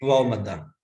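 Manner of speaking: वाव मद्दा with the lips rounded. Shown as a demonstration. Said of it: "उसके बाद देखिए वाव मद्दा".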